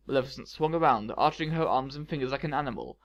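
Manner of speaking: usual speaking voice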